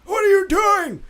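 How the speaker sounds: put-on high, loud old-man yelp; startled old man. Old man to the stranger who caught him, startled shout. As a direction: cry out in disbelief, cracked and high. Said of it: "and he's like".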